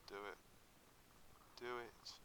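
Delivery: usual speaking voice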